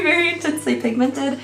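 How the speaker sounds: normal talking voice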